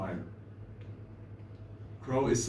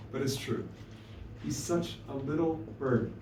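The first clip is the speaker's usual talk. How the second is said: ordinary delivery, nothing marked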